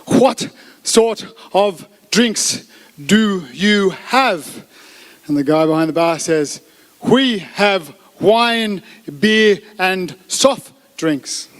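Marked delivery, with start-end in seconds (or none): 0.1-4.7 exaggeratedly slow, word by word; really slow speech. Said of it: "just speak really slowly". 7.0-11.5 exaggeratedly slow, word by word; really slow speech. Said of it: "just speak really slowly".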